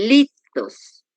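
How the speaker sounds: "litos" slowly, LI stretched and stressed; stress on first syllable. Shown as a demonstration. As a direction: lean hard on LII-tos. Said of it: "dónde está el acento para hacer la fuerza".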